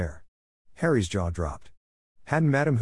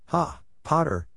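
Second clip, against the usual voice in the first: high, mildly raised retort; said loudly; 8 semitones higher, slower than usual. A narrator's reading. Draco answers Harry's terms, mildly loud. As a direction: pitch it well up without shouting. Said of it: "said Draco loudly".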